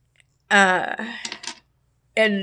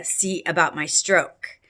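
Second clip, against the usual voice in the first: ordinary talk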